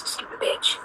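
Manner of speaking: whispered to herself under the breath; soft whispered self-insult; self-directed mutter. Real, not acted. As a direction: scold yourself quietly, almost unheard. Scolding herself for the diary.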